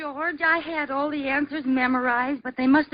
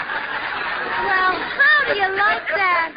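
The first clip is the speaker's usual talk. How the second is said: thrilled squeal; 6 semitones higher, much slower than usual. Acted. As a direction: gush with surprised joy at the win. Liz thrilled at winning.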